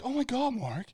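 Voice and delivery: high, shocked pearl-clutching voice; mocking scandalised crowd. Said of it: "everybody's like".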